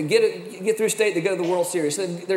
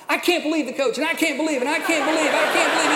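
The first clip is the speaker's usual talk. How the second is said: higher mock whine of a kid, repeated; imitating an upset boy; 7 semitones higher, faster than usual. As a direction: echo the son's outrage, slightly hammed. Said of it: "He starts going".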